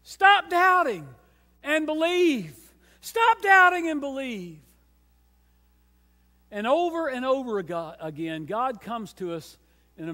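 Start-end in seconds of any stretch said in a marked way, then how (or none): 0.0-4.6 loud, high, ringing preacher's cry; full-force preaching. Acted. Preacher rallying the church toward Sunday, loud high cry. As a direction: lift the congregation with it.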